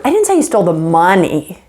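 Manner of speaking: "money" emphasized at the very end; stress on MONEY. Shown as a demonstration. Teacher changing the last word, stress on "money". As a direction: run up to "money" and land on it. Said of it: "you could change the last word".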